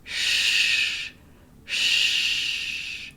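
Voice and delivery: drawn-out "Shh" hiss; voiceless "Shh" demo; sh sound demo. Shown as a demonstration. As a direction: hold "Shh" with bunched tongue. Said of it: "bunching your tongue up".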